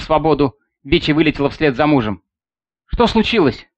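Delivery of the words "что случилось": worried question, pitched high; alarmed, concerned father asking; anxious. Acted. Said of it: "с тревогой спросил".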